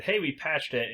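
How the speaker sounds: ordinary talk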